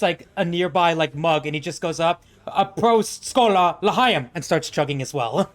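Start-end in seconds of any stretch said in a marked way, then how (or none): 2.5-4.3 raised, loud string of cheers; rousing toast. Acted. Wukong toasting back with a mug, rousing toast. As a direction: toast loudly before chugging.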